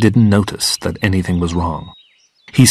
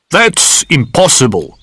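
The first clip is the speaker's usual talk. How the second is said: higher, mildly angry denial; angrily; 5 semitones higher than usual. A narrator's reading. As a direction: reject it with indignation. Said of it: "said Goldfinger angrily".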